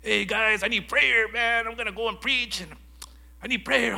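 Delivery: high, loud, eager call for prayer; excited plea. Retold or imitated. To his men's group, asking eagerly for prayer. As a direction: pump it up, asking the guys for prayer.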